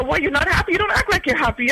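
puzzled teller. Retold or imitated. Retold bank teller prodding her.

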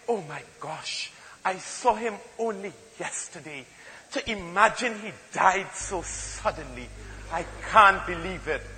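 soft, sad, hushed standard English; mock-sorrowful hush. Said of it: "A Trinidadian will never say".

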